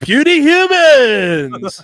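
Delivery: high, loud, slow alien villain. Pretend. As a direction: boom it like a cartoon alien invader. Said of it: "Take me to your leader" type of voice".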